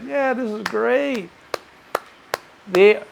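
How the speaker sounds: high, slow, pleased-with-himself mock voice; mocking Biden. Mocking the president for welcoming the immigrants, high and slow. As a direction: lampoon him as cheerfully clueless, drawn out. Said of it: "Biden's like".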